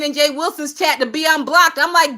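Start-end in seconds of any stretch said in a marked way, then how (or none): none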